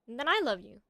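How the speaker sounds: ordinary talk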